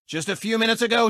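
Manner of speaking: ordinary delivery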